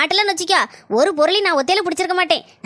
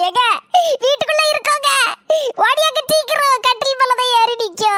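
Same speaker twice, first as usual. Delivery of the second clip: calling for help; 7 semitones higher than usual. Acted. Trapped woman calls out for rescue. (உதவிக்கு உயர்ந்த குரலில் கூவுதல்)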